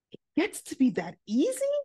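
high, rising incredulous question; amazed disbelief. Retold or imitated. Student amazed at forgiveness, rising high in disbelief. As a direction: let the pitch climb in wonder.

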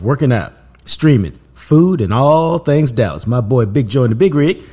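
loud, punchy show-opener delivery; podcast intro read. Acted. Host introducing his Cowboys podcast to listeners, punchy intro read. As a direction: announce the show with loud, driving energy. Reads the show's opening intro.

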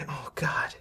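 hushed, voiceless aside; soft breath of horror; whispered dismay. Acted. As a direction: whisper it in acted shock. GM voices woman glimpsing synth.

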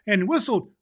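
lively storybook read-aloud; story narration. Acted. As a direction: read it like a bedtime tale.

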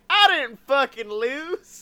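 high, slow, indignant politician impression; mock sore loser. Defeated candidate still denying the loss, mock indignation. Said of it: "the not decent person is still like".

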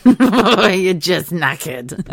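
slow, laughing fondly. Real, not acted. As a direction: laugh it out, sympathetic. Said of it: "laughing at herself".

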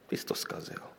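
whispered accusation, all breath and no voice; accusing whisper. Acted. Job's friends blaming him, accusing whisper.

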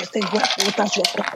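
high, angry imitation of mom cursing; furious mom. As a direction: spit the curse at the thief. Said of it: "she's like".

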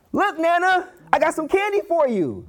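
put-on high, eager little-kid voice; excited child. Grandchild bringing Nana a gift, excited child voice. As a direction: pipe up high like a delighted grandchild. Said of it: "The child's excited".

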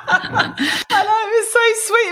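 laughing high, words breaking up; breaking into laughter mid-sentence. Real, not acted. Laughing while retelling son's offer.

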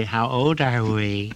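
slow doting granny voice; grandmother. Retold or imitated. Grandmother asking the little boy his age, slow doting voice. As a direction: coo it like a grandmother to a small boy. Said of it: "his mother would say".

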